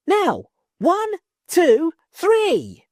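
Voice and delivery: high, loud shouted count; children yelling together. Acted. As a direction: yell it out to the village.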